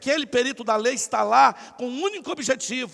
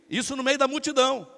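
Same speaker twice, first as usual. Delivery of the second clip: usual speaking voice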